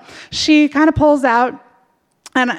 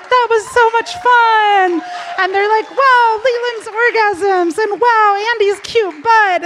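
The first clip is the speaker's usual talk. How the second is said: put-on gushing girls; 9 semitones higher, slower than usual. Girls gush, voiced high.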